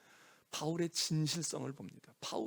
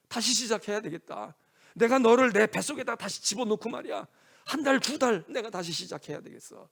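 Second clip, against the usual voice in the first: loud, raised-pitch impassioned vow; Paul as anguished parent; 6 semitones higher, 7 dB louder than usual. Acted. Paul as a mother to a straying child, anguished insistence. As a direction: plead with parental desperation. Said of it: "그 바울이 얘기하는 거야".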